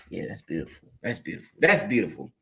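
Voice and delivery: very soft, low murmur; hushed awe. Real, not acted. Savouring being the activity of God, hushed and low. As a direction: repeat it quietly to yourself, savouring each time. Savouring his own declaration.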